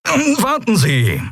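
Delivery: high, drawn-out call; high-pitched plea to stop. A narrator's reading. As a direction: call someone back urgently, stretching the words. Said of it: "rief Delarco".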